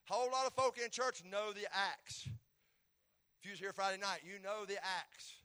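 announced preaching push. Acted. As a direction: lean in and preach the line. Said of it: "I'm gonna preach it".

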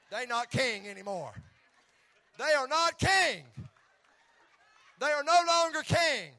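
loud, high, laughing proclamation; high shouted joke with laughs. Acted. Joke punch line shouted laughing.

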